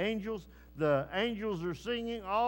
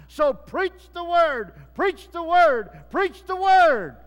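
rising, slower, louder with each repeat; preaching at full force; 9 semitones higher, 13 dB louder, slower than usual. Acted. Preacher urging the congregation to preach, in a full-force cry. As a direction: hammer the call home like a revival preacher.

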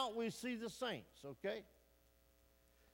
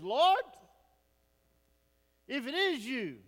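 loud, high cry to the Lord; calling out; 4 semitones higher, 8 dB louder than usual. Acted. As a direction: call out across the waves, pitch leaping on the first word. Peter calls to Jesus over waves.

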